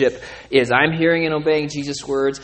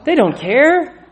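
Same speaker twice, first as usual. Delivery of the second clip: fired-up preaching; 10 semitones higher, 6 dB louder than usual. Real, not acted. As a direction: hammer home that the referees don't care. Preacher peaking on referees.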